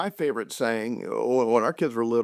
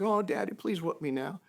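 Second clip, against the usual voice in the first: put-on higher, pleading child, softer; begging little kid; 6 semitones higher, 7 dB quieter than usual. Little son begging his dad to roughhouse now, lightly voiced.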